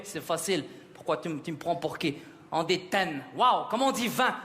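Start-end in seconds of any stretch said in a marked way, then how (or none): 0.1-3.2 low-voiced, dismissive dad; lower, scoffing paternal reply; self-assured father. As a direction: answer low, as if it were obvious. Father answers son's quiz, offended.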